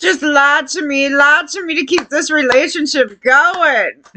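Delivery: high-pitched mocking wife voice; mock pleading wife. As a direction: parody a wife begging to be lied to. Mockingly voices the wife after clip.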